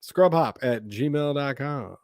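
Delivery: slow dictation. Shown as a demonstration. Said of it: "repeats email slowly".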